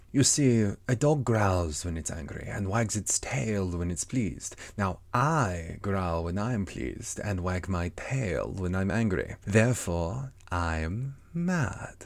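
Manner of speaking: slow, drawn-out cat voice; Cheshire Cat. Acted. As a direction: stretch the words out like a leisurely storybook cat. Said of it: "the cat went on".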